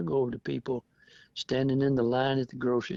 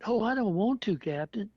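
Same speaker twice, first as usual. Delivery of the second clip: whiny recruit mock; 9 semitones higher than usual. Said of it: "You gotta take the covid shot".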